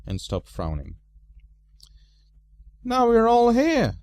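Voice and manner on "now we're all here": high, loud wizard's cry; loud high old wizard; Gandalf's voice. A narrator's reading. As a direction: boom out the greeting as the wizard. Gandalf speaking, big voice.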